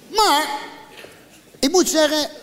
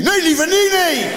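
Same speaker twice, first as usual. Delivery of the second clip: high, louder whiny mock echo; mocking reply; 9 semitones higher, 4 dB louder than usual. Comedian mocks the doctor's timid answer, whiny. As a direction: ape his timid answer.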